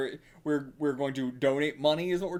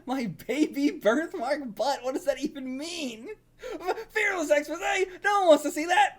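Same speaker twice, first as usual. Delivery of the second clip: laughing disbelief; 7 semitones higher than usual. Real, not acted. Disgusted onlooker laughing.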